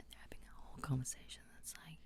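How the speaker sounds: quick hushed whisper. Shown as a demonstration. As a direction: whisper it fast like on set. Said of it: "drop it right down".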